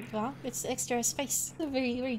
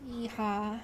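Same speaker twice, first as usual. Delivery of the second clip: cowboy voice; 3 semitones lower, 5 dB quieter than usual. Pretend. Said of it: "Can you do a cowboy voice".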